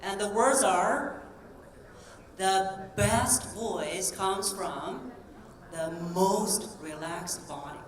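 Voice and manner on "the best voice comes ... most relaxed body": "best" and "most" drawn out on more breath; stress on best, most. Shown as a demonstration. Coach showing where breath is released, "best" and "most" stretched. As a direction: slowly, let extra exhalation go on "best" and "most". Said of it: "so the word best and most".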